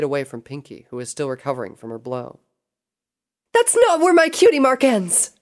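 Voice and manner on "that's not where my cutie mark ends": high, loud character shout; indignant shout. A narrator's reading. Pinkie fighting back against her attacker, indignant shout. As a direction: shout it out high and full force. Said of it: "Pinkie shouted".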